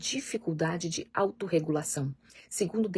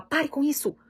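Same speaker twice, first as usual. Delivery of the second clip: sharp, high and louder "Pare com isso"; harsh scolding; 8 semitones higher, 4 dB louder than usual. Retold or imitated. Parent scolding a child for grabbing a toy, snapping. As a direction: snap the order at the child. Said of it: "Ao invés de falar".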